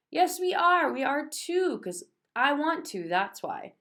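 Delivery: high, loud stubborn shouting; child defying Mother in a tantrum; defiant tantrum. A narrator's reading. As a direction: stamp the foot and insist. Child stamps foot, defies mother.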